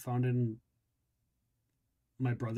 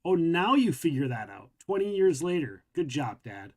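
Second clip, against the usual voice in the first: louder, higher outburst that falls off at the end; angry sarcasm; 3 semitones higher, 3 dB louder than usual. Acted. Ronnie slams things down and turns on Dad, angry sarcasm. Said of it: "slams down whatever he's got in his hand".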